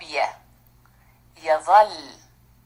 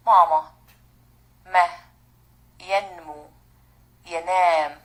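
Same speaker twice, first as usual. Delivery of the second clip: usual speaking voice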